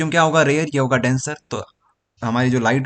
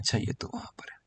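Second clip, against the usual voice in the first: muttered aside; 6 semitones lower, 15 dB quieter than usual. Real, not acted. Aside to himself at the board.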